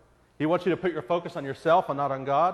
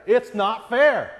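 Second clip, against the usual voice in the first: anguished cry; 8 semitones higher, 7 dB louder, much slower than usual. Acted. Psalm lament, peak of anguish.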